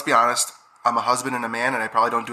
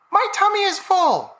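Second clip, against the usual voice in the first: very high, slow tiny belly voice; squeaky little tummy speaking slowly; talking stomach; 3 dB louder, slower than usual. Retold or imitated. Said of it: "she was voicing what her belly says".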